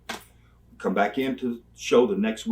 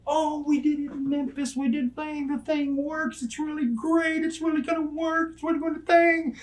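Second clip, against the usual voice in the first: high, put-on mocking voice throughout; high mock impression of the eager booker; mocking Jerry Jarrett. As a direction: mimic the booker pitching his idea, high and silly. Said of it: "he's just fun puzzle".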